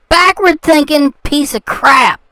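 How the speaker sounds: normal talking voice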